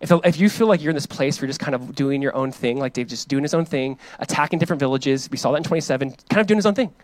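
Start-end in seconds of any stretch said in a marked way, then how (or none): none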